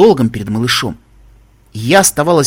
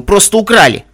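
louder, higher, indignant build to a peak; raised voice; 7 semitones higher, 7 dB louder than usual. A narrator's reading. Narrator arguing over the stolen water talisman, raised voice. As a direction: raise your voice on the accusation. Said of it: "всё повышая голос".

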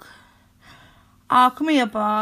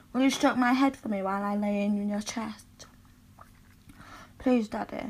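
soft afterglow; 6 semitones lower, 5 dB quieter than usual. Acted. Lover to partner afterward, lower and softer. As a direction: lower and softer, intimate murmur.

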